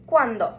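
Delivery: ¿Cuándo? said KWAN-doh for the class to repeat; Spanish pronunciation. Shown as a demonstration. Teacher says ¿Cuándo? for students to echo. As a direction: model it once. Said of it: "Repeat after me".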